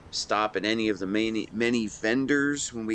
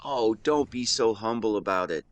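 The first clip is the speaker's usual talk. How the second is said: usual speaking voice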